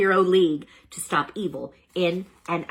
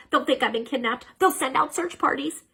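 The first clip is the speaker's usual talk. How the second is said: worried; 8 semitones higher than usual. Acted. Hamster fearing kidnapping alarm.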